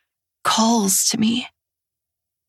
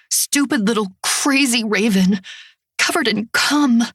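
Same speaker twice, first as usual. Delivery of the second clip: ordinary delivery, nothing marked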